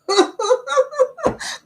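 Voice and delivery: high giddy laugh; high giddy laughter. Real, not acted. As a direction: laugh giddily. Laughing after cheer.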